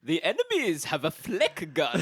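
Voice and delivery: lightly put-on German game announcer; German accent. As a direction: call it out like a war game narrator. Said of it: "That's Borat".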